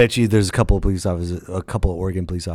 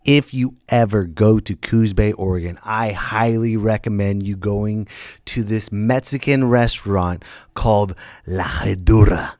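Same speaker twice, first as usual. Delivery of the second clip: ordinary delivery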